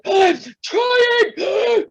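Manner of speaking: high, loud strained cry; desperate cry. Retold or imitated. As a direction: cry out desperate, struggling for air. Gasping reply to husband.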